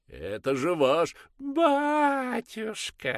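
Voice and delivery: sung in high falsetto, slow; mock the priest in falsetto; falsetto sing-song. Father mocking the priest, singing falsetto. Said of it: "пропел отец, перейдя на фальцет".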